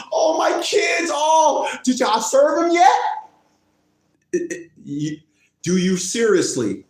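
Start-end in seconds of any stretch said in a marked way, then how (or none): 0.1-3.0 high fake wail; hammed-up distraught mother. Mother asks if he's been served, high mock anguish. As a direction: wail in exaggerated mock despair.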